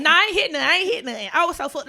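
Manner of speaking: high and loud, indignant denial; loud protest. Real, not acted. Answering the co-host's teasing with a loud denial. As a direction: shout it down, you hit nothing. Defending herself, co-host teases.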